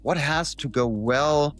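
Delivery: ordinary talk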